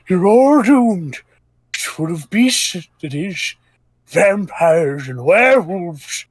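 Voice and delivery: put-on slow, high doom-saying old man; slow ominous raspy drawl. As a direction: warn them like a crazed old man. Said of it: "an old man passing by".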